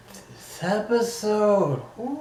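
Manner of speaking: high, slow, eerie wavering tone; drawn-out spooky ghost moan. Real, not acted. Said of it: "I did not mean for that to sound like a ghost".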